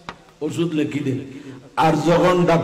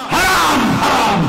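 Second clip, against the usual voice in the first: forbidding shout; 8 semitones higher, slower than usual. Acted. Preacher warning the crowd off bad sermons, shouting.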